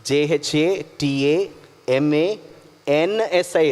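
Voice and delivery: జేహెచ్ఏటిఏఎంఏఎన్ఎస్ఐ read letter by letter, very slowly; spelling out the English letters for listeners to type. Shown as a demonstration. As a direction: dictate each letter for the search box. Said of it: "Google-లో మీరు ఒకవేళ type చేసి చూస్తే".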